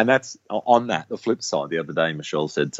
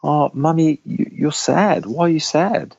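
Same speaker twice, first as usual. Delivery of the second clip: high-pitched imitation of a concerned toddler; child's voice, pitched well up; little boy; 8 semitones higher than usual. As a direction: mimic a small child asking mummy what's wrong. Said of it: "he already picks up on it".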